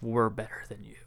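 hushed nature-narrator voice, near a whisper; David Attenborough. Acted. Old narrators looking down on everyone, whispered narrator impression. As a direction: whisper it like a superior documentary narrator. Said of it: "David Attenborough motherfuckers, and they're like".

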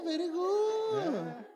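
high, very drawn-out praise; mock praise. Praising himself for counting in Urdu, comically drawn out. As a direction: stretch it out, swooping up and down.

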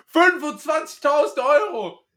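high, loud hype shout; sales coach yelling. Retold or imitated. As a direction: scream it like a sales trainer. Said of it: "da wird immer geschrien".

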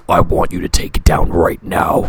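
low, loud, breathy movie villain voice; low haunting voice. Retold or imitated. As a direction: growl it like a menacing movie voice. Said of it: "it's the voice of, uh, the movie we've seen".

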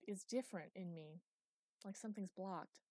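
ordinary delivery, nothing marked

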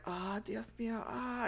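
softly muttered mock prayer; imitated praying. Retelling Ramirez's immediate reaction, hushed mock prayer. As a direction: invoke God under your breath, lightly acted. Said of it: "and he prays".

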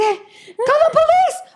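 imitating a frightened kid in the prank car, half laughing; panicked kid. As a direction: shriek like a scared child. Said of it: "comenzaban a freak out".

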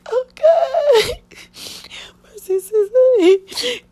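high, breaking sob; real crying. Real, not acted. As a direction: sob it out to her friend. Crying as she recalls.

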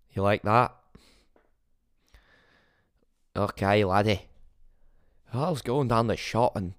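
lively put-on Scottish storyteller; Scottish accent. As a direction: spin a pub yarn in a Scottish accent. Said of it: "That's how I do a Scottish accent".

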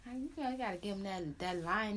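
ordinary talk, nothing marked

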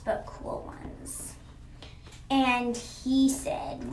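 ordinary delivery, nothing marked

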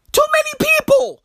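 comic high-pitched protest; high-pitched exasperation. Acted. Comedian objects to crowded gatherings, high exasperation. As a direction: squeal the complaint in mock dismay.

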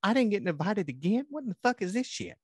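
high-pitched mock complaint; indignant woman. [name] complaining about not getting invited, high mock indignation.

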